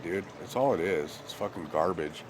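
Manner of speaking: normal talking voice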